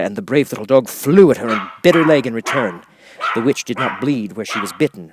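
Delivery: ordinary delivery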